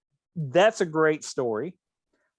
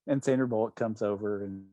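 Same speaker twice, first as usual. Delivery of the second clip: normal talking voice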